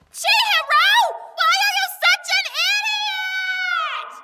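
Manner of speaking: calling out. Acted. Calling out after friend.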